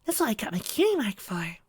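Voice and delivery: high, gentle pony voice; timid Fluttershy. A narrator's reading. Fluttershy explaining chicks to Trixie, in a gentle high voice.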